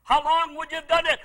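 normal talking voice

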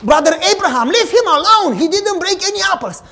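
put-on high, loud childish plea; pleading child. Boy begging the caretaker at his door, loud and pleading. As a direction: beg the caretaker to stop.

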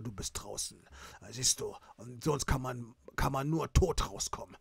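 low, quiet menacing voice; menacing boss. Acted. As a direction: threaten calmly from deep down.

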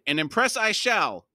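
high, loud declaration; triumphant cry. A narrator's reading. Krupp vows to impress the company, loud cry. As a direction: cry it out with grand confidence. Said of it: "Krupp cried".

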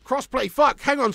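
blurted out high and loud; sudden realisation. Real, not acted. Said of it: "There's something wrong with my brain".

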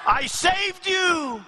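high, drawn-out cry of betrayal; acted wail of reproach; anguished cry. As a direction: cry out slowly in protest. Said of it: "cried the woman".

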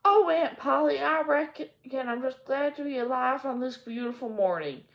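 put-on high, eager little girl; bright child greeting her aunt; Pollyanna. A narrator's reading. Pollyanna greeting Aunt Polly, eager high voice.

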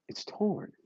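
hushed whispered repeat; whispered echo. Real, not acted. Grim echo of his image.

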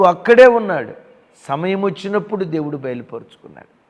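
loud sermon exhortation; preaching. Acted. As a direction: press the promise home to the flock. Pastor preaching to congregation.

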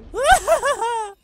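high, loud cackle; manic cackle. Pretend. Player gloating over game kills, high manic cackle. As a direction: cackle high in mock triumph.

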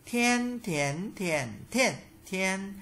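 "Thiên, thiến, thiền, thiên, thiên" through the tones, high level first; high, rising, dipping, falling takes of "Thiên, thiến, thiền, thiên, thiên"; tone drill. Shown as a demonstration. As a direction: recite "Thiên, thiến, thiền, thiên, thiên" changing only the pitch contour. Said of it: "phần luyện tập về thanh điệu".

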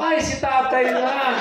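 high, slow mock discovery; mock surprise. Mourner opening the coffin, high slow mock surprise.

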